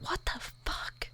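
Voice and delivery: slow unvoiced whisper. Real, not acted. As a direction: breathe it out in shock. Reacting to the story.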